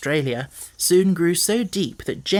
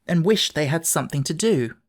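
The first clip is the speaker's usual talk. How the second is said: usual speaking voice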